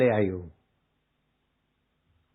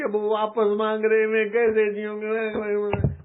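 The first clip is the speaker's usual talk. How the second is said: hammed-up weeping wife; 6 semitones higher than usual. (गहनों के लिए रोती पत्नी की नकली, ऊँची रोनी आवाज़)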